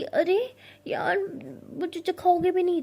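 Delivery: pleading for a taste of chocolate; whiny pleading. Acted. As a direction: beg like a sulky child. Begging for chocolate.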